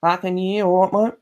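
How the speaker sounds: cheeky bogan Australian mate talk; bogan Aussie. Retold or imitated. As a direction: play the bogan Australian bloke. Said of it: "Sometimes I sound so bogan".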